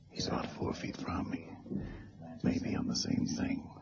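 hushed, breathless aside; murmured inner planning under threat; whisper. Acted. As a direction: whisper so the gunman can't hear. Hero's aside during a hold-up.

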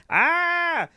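high panicked yelp; a quick mock scream of panic; hammed-up shriek; imitated scream. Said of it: "I just start going".

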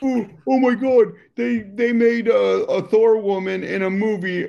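high, loud caricature of a scandalized complainer; hammed-up falsetto pearl-clutching; mock outrage. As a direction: whine it high, mocking the outraged. Mocks outraged complainers again.

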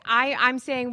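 ordinary delivery

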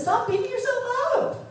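husband urging wife to stop self-criticism, high and pleading; husband insisting. Retold or imitated. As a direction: pitch it up and press him.

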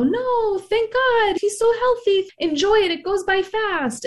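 high, sing-song brush-off of a mother; breezy, high, mock cheerful mother; dismissive mom. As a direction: gush over the blessings to shut her down. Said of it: "she would go".

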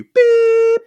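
long, high, loud voicemail beep; imitated beep. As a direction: cut in with a piercing mock machine tone.

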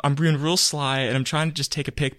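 usual speaking voice

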